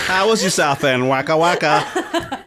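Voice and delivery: hammy pun with a wink; punchline voice, waka waka; Tim Vine pun delivery. Retold or imitated. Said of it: "you're like Tim Vine".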